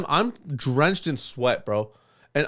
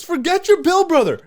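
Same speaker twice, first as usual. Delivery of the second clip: owner's line, high and loud through laughter; cracking up mid-quote; laughing retelling; 13 semitones higher, 6 dB louder than usual. Retold or imitated. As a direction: quote him laughing hard.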